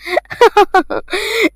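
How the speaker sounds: short mocking laugh; sarcastic laugh. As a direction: laugh off the father's curse scornfully. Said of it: "ضحكت بسخرية".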